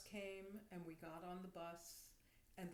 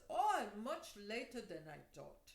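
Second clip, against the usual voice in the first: imitated Indian accent, louder on the opening; 7 dB louder than usual. Little man reacts to hearing the time, Indian accent. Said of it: "he speaks with an accent".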